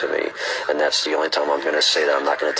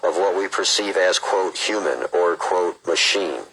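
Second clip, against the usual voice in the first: slow, measured read-aloud; book reading; 4 semitones higher, slower than usual. Acted. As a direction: read it out deliberately, phrase by phrase.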